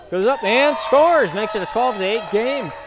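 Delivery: high, loud, slow scoring call; excited shout. Real, not acted. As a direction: yell the basket like an announcer. Scoring call in live commentary.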